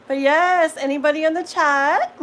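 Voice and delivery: loud, bright call to the chat. Real, not acted. Streamer calling out to her live chat, loud and cheery.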